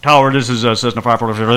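low clipped radio call to the tower; a light hint of radio chatter; pilot radio call. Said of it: "I gotta get on the radio".

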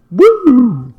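loud high mock siren wail; siren whoop. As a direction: imitate a police siren. Imitated police siren.

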